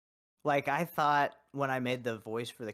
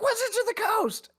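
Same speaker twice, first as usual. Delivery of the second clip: squeaky mock question. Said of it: "He's like".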